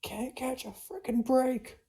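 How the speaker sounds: high, slow exasperated whine; exasperated complaint. Acted. Watchman grumbles at yet another attack, high exasperated complaint. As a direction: gripe in a high, drawn-out voice.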